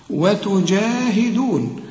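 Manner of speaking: "وتجاهدون" with a lengthened aa after ج; slow recitation, stretch the long vowels of "وتجاهدون"; natural madd. Shown as a demonstration. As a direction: recite "وتجاهدون" slowly and evenly. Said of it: "في نهايتها المد بتاعها".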